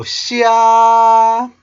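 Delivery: Xia held high and steady. Shown as a demonstration. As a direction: Xia na wysokim, równym tonie. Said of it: "high pitched tone".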